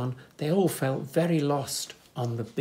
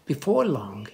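ordinary delivery

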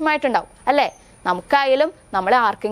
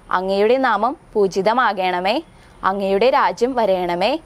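prayer recitation; 4 semitones lower than usual. Acted. Teacher leads children's prayer.

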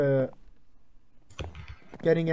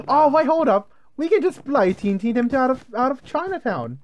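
higher and louder, bursting with a new plan; excited sudden idea; 9 semitones higher, 5 dB louder than usual. Real, not acted. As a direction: blurt it out like you just cracked it. Sudden idea mid-game.

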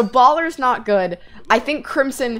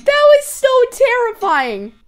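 breathless relief; 11 semitones higher, 3 dB louder than usual. Real, not acted. Relief after scare.

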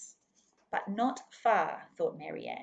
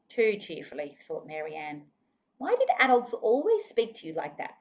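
usual speaking voice

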